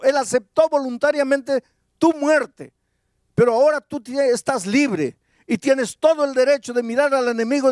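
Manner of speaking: proclama más agudo, con fuerza y convicción; predicación intensa. Acted. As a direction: predica a pleno pulmón a la congregación. (predicador que exhorta con fervor)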